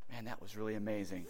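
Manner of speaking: low, soft, quick aside; mock awe, talking to yourself; hushed musing. Musing alone after staged chat.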